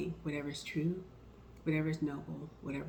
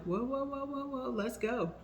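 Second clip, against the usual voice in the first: higher, rushing whoas to calm her; startled soothing whoa; 8 semitones higher than usual. Retold or imitated. Coworker sees a student burst into tears, startled and soothing. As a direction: catch the crying student gently but alarmed.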